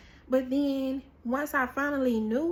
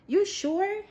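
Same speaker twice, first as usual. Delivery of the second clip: doubtful double-check; 7 semitones higher, 3 dB louder than usual. Retold or imitated. Acts out doubting release news.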